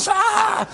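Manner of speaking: sudden high yelp of alarm; a sharp high gasp-cry at the gunfire; startled cry. Retold or imitated. Refugee hearing soldiers burst in, high startled cry.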